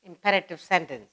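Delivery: usual speaking voice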